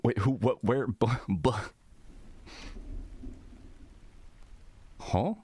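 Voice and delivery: totally thrown, rising to a high "huh"; baffled. Real, not acted. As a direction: sputter questions in bewilderment. Baffled by random chat messages.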